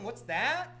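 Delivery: ordinary delivery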